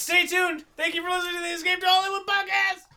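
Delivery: mock announcer outro. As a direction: sell the outro like a cheesy DJ. Podcast outro read.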